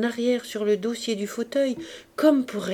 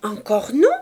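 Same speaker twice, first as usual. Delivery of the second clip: loud, rising disbelief; indignant question; 7 dB louder than usual. A narrator's reading. Cosette refused by her husband once more, indignant question. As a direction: ask it in pouting disbelief.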